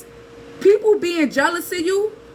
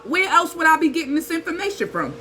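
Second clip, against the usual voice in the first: normal talking voice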